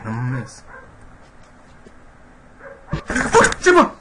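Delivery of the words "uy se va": startled yelp. Real, not acted. Monster suddenly appears.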